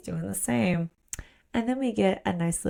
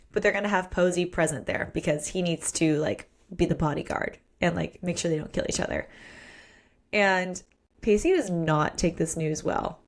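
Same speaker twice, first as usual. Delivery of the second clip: normal talking voice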